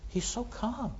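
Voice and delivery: ordinary delivery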